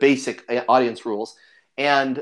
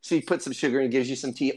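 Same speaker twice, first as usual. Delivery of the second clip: ordinary talk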